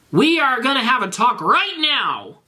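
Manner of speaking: high, stern scolding. Acted. Ebony scolds Garfield, high stern warning. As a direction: lay down the law.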